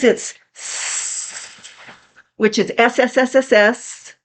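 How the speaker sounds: long hissing s, snake-like; drawn-out s like a snake. Shown as a demonstration. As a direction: hiss the s like a snake. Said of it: "like a snake".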